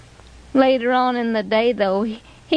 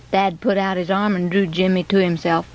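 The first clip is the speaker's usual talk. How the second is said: ordinary delivery, nothing marked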